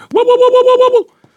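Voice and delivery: very high, loud howling woe woe; a spooky whooping cry from the bushes; sinister war whoop. Acted. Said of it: "klonk het sinister uit de struiken". (sinister gehuil, hoog en luid)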